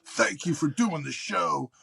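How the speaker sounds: high, slow, gushing supporter; lightly mocking grateful fan. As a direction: ham up a fawning fan. Quoting supportive fans.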